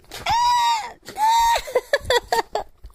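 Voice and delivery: high shrieking laughter; loud squealing laugh. Real, not acted. Owner buried in dog kisses, shrieking laugh.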